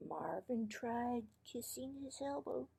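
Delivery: plain reading voice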